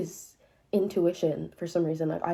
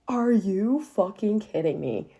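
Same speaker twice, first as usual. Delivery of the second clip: slow, louder disbelief; incredulous outrage; 3 semitones higher, 4 dB louder, slower than usual. Real, not acted. Telling viewers his excuse, slow incredulous outrage. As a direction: drag out each word in disbelief.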